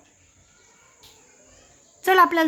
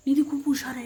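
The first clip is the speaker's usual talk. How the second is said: put-on softer, gently modelling निधी खूप हुशार आहे for the child; soft coaxing prompt; 5 dB quieter than usual. Shown as a demonstration. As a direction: softly show the child what to say, stressing Nidhi. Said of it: "हे बघ". (हळू, प्रेमळ आवाजात मुलीला शिकवत)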